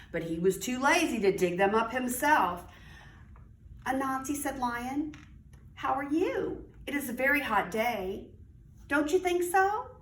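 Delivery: lively storybook read-aloud; reading to children. A narrator's reading. Teacher reading aloud to young children, animated storytelling. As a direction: read it like story time for little kids.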